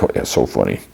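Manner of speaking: low, voiceless sarcastic jeer; dry mock amusement, dropped low; sarcastic mockery. Jock jeering at the nerd's joke, low sarcasm.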